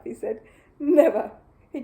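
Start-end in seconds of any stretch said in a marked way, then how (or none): 0.8-1.3 very loud high snap; furious cry. Acted. Mister Curry storming out, furious. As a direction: bark the final word.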